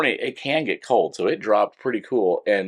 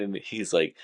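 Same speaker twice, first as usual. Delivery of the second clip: ordinary talk, nothing marked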